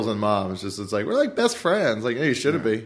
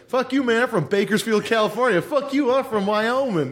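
much higher, belligerent mock rural accent; rural accent bit; 8 semitones higher than usual. Retelling a comedy bit to friends, in a mock rural accent. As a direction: snap it out like a proud small-town guy, rural accent. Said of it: "that old David Cross bit where it's like".

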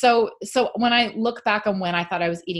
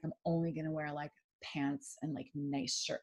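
hushed self-talk; 3 semitones lower, 13 dB quieter than usual. Retold or imitated. Retelling her own French resolve.

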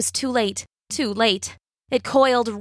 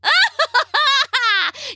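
high, loud wicked cackle; long shrill witch laugh; witch's cackle; 4 dB louder than usual. A narrator's reading. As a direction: shriek with gleeful laughter. Witch finds Rapunzel climbing.

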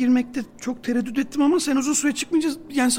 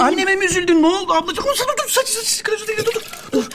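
high-pitched, flustered urgency; panicked fussing; 6 semitones higher, 5 dB louder than usual. Acted. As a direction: fuss frantically over her, repeating words in a rush. Kusan ablanın saçını telaşla tutuyor. (panik içinde, tiz sesle)

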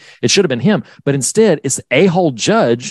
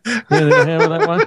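mocking, laughing; 11 semitones higher, much faster than usual. Mocking line voiced fast.

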